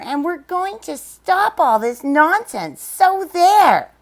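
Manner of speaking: mocking monkey shout. Wickersham brothers mocking Horton, loud jeering shout. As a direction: jeer at him like rowdy monkeys. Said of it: "The Wickersham brothers came shouting".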